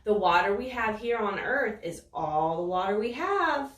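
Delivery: plain reading voice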